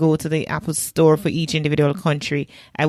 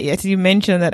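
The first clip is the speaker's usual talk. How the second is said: ordinary talk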